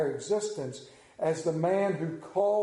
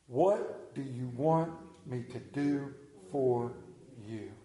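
softer and slower, a hushed invitation; slow shared reading; 4 semitones lower, 3 dB quieter, slower than usual. Shown as a demonstration. Pastor leading his congregation through the verse, slow and soft. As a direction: read it slowly and gently, word by word. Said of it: "Read that with me".

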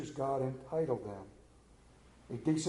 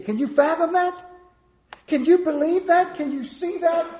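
much higher, louder marvelling questions; excited questions; 14 semitones higher, 10 dB louder than usual. Real, not acted. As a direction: ask them in rising wonder, high.

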